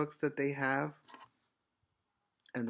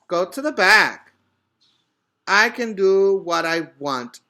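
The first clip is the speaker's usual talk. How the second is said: much louder, higher bossy shout; shouting quarrel; 4 semitones higher, 10 dB louder than usual. A narrator's reading. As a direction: shout them down. Children quarrel at lunch line.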